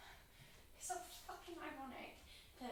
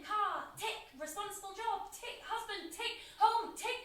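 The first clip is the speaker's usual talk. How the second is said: mocking checklist; 7 semitones higher, 9 dB louder, slower than usual. Mocking her friend's checklist life.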